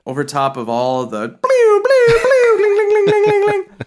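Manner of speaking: high, loud chiming bling bling; slot machine noises. Retold or imitated. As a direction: imitate jackpot slot machines ringing. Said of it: "the slot machines going off".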